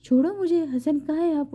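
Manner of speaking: frightened cry for her husband; terrified plea. A narrator's reading. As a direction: beg to be let go, panicking. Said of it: "وہ ڈرتی ہوئی کہہ رہی تھی".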